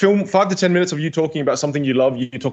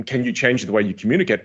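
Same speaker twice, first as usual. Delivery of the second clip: usual speaking voice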